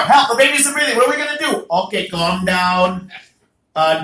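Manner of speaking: normal talking voice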